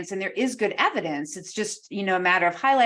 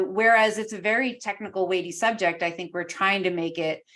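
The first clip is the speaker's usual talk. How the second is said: normal talking voice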